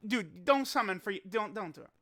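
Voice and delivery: usual speaking voice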